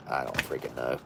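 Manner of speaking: low, soft, defeated mutter; exasperated giving up. Real, not acted. Giving up on pronouncing the French label, defeated. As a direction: throw up your hands at the French.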